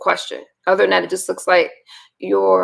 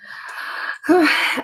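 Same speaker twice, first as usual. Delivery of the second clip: weary sigh; 5 semitones higher than usual. Real, not acted. Tired sigh starting the stream.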